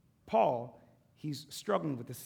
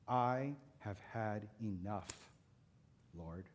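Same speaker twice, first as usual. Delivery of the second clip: slow and softer, worn out; weary despair; 5 semitones lower, 5 dB quieter, much slower than usual. Acted. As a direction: sink into exhausted surrender. Elijah's despair voiced.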